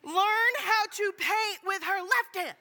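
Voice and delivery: slow, high, emphatic yell; very loud outburst. Retold or imitated. Finishing blurted answer to the Kroger crowd, very loud. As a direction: yell each word out slowly in exasperation. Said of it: "I respond very loudly".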